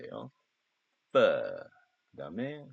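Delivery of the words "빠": 빠 said as an English f, upper teeth on lower lip; f sound demo. Shown as a demonstration. Teacher showing students the f sound, biting lip on 빠. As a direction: bite the lip and blow out 빠 like f. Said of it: "윗니로 아랫입술 깨무는 발음".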